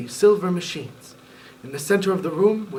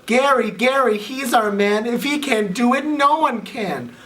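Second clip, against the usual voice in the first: high, loud chanted cheer; 5 semitones higher, 3 dB louder, slower than usual. Acted. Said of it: "a group of cheerleaders called out".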